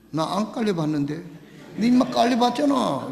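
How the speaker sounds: playing both boys, chuckling through it; laughing mock quarrel. Preacher acting out a village boys' quarrel, laughing. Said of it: "이렇게".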